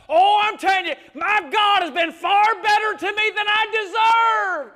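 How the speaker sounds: loud, high testimony building to a shout; full-force preaching. Real, not acted. Preacher testifying what God did for him, building to shouted praise. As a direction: drive each phrase harder, stretch the climax.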